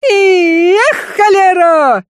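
slow booming yell; booming shout. A narrator's reading. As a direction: thunder it across the yard. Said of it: "зычно прогремело над двором". (зычный крик)

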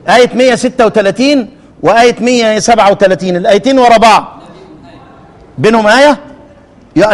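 ordinary talk

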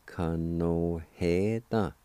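Kanoheda drawn out slowly as one word; slow joined word. Shown as a demonstration. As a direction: stretch Kanoheda slowly for the class. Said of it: "And together".

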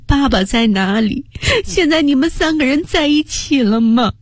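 high sobbing plea; tearful prayer. Acted. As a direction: cry out a prayer to mother. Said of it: "哭着祷告".

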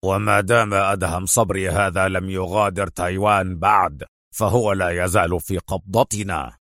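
contained anger, low voice; a commander's understated fury; enraged. A narrator's reading. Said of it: "يضيف في حنق وغضب".

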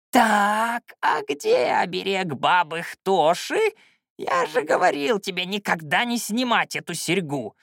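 put-on high, creaky old man's rant; indignant old shaman. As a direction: interrogate the pupil, aghast and cross. Old shaman demands the missing amulet.

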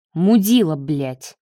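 plain reading voice

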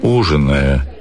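ordinary delivery, nothing marked

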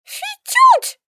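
high cursing whistle; angry whistle. Acted. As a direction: whistle it like a swear word. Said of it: "припечатал явно каким-то нехорошим словом".

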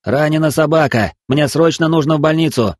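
urgent shout from a car window. Acted. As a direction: yell it out, desperate and loud. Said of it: "крикнул".

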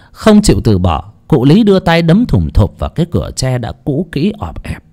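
plain reading voice

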